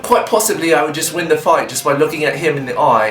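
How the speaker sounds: light put-on English accent; a touch of posh English accent throughout. Answering fan questions in a mock English accent. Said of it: "try and do a video entirely with an English accent".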